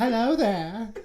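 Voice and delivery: loud, rising "hello there" in a mock Kenobi voice; Obi-Wan impression. Cohost quotes Star Wars as a mock Obi-Wan. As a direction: channel Obi-Wan, hammy. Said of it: "Ewan McGregor".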